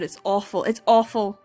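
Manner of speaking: normal talking voice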